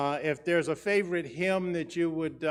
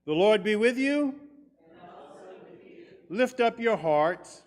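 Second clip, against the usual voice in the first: cathedral echo; 3 semitones higher than usual. Acted. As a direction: ring it out across the church. Liturgy intoned to congregation.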